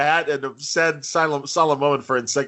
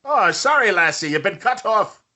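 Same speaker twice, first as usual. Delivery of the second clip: put-on Irish accent, pitched up, cutting her off; Irish brogue barkeep voice, higher; mock Irish bartender; 7 semitones higher than usual. Bartender hologram refusing a drunk in Irish accent. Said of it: "You can do an Irish accent".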